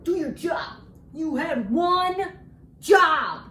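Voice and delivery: loud, high exasperated yelling; mock scolding shout. Baker telling off the marble slab, exasperated shout. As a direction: scold it, drawing out the last words loudly.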